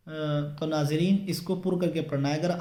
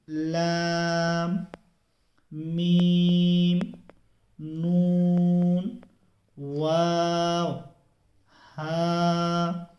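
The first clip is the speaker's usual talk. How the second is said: each letter name drawn out slowly; slow letter recitation; much slower than usual. Shown as a demonstration. Teacher reciting letters for viewers, slow and clear. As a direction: recite the letters one by one, stretched. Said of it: "teacher reciting the alphabet".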